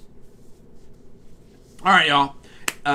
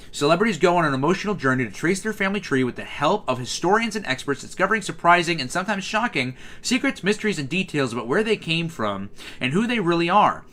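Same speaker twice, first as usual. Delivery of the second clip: higher, sing-song promo read; show blurb read-out; 4 semitones higher than usual. Acted. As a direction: read it like a TV listing voiceover. Reads a show blurb aloud.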